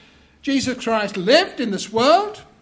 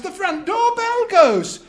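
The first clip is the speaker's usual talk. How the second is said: ordinary delivery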